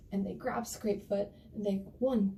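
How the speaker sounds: plain reading voice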